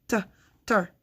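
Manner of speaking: "T" as "tuh", then "ter"; T alone before the syllable ter; isolated sounds. Shown as a demonstration. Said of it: "That's another vowel sound".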